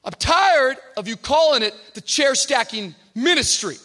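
loud, high, quick challenge; tough kid confronting. Retold or imitated. Tough young guy challenging his pastor, loud and high. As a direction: get in his face with it. Nelson complains loudly.

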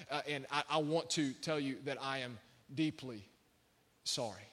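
low, soft, halting sincerity; sincere apology. Retold or imitated. Friend apologising to man he fought, retold softly and sincerely. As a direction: drop low and pause before the last word.

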